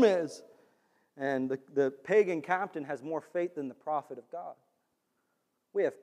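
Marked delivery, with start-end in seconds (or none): none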